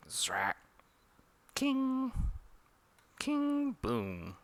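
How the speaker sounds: ping it twice, then a soft boom; soft bouncing-spell noises; comic sound effects. Acted.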